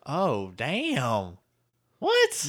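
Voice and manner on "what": loud, very high startled question; genuinely floored; real surprise. Real, not acted. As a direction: shoot way up high in disbelief. Reacting to a surprising revelation.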